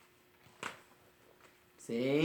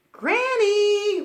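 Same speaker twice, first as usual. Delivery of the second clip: high, loud sing-song call; 10 dB louder than usual. Acted. Red arriving at the cottage calls for Granny, high and loud. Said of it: "Red called out".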